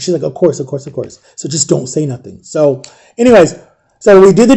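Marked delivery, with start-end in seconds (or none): 0.2-2.4 hushed warning. Retold or imitated. Whispered warning in the car.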